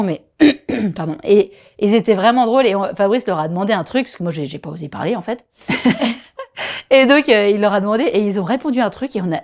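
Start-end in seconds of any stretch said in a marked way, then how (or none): 5.7-6.9 embarrassed laugh. Real, not acted. Laughs at own shyness.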